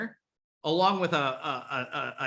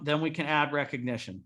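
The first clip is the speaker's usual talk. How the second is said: usual speaking voice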